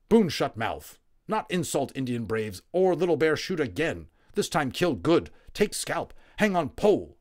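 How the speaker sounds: loud, clipped angry threats; angry Indian warrior. A narrator's reading. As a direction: threaten him fiercely. Little Bear voiced angry.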